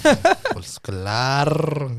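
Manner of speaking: "claro" drawn out with a long trilled R; slow, playful roll of the R in "claro"; showy rolled R. Shown as a demonstration. Shows off rolled R after praise.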